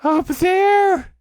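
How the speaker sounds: very high, loud frantic squeal; high-pitched alarmed cry; Piglet. A narrator's reading. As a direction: point it out in a panic. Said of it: "said Piglet, waving his paw".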